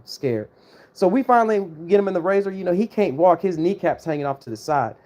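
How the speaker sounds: plain reading voice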